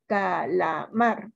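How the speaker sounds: "Calamar" very slow in separate syllables, MAR marked; stress on MAR. Shown as a demonstration. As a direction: sound out "Calamar", landing on "mar". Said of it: "¿Cuál es su sílaba tónica?". ("Calamar" muy lento y separado, marcando MAR)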